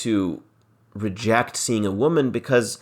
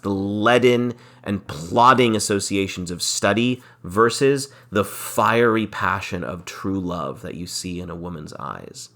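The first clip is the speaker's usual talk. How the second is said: normal talking voice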